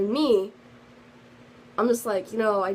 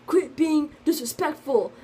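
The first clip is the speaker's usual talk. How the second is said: mock stern scolding, pitched up and a little louder; retold yell; 5 semitones higher, 4 dB louder than usual. Teacher scolding the student in the hallway, a mildly mocked stern rebuke. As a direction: imitate the teacher snapping at her, slowing on the last words. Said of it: "yells at me".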